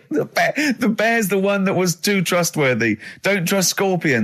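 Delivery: high-pitched, amused exasperation; real laughing protest. Real, not acted. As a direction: correct him through real laughter. Cracking up at a reply.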